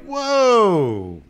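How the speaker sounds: mock-astonished wife, voice well up; amazed. Admiral's wife sees him leave his wheelchair, amazed.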